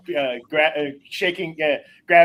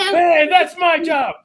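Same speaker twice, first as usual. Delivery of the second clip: high, loud, drawn-out protest; hammed-up offended shout; indignant announcer; 12 semitones higher, 8 dB louder, much slower than usual. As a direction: object like a usurped showman. Announcer character protests being replaced.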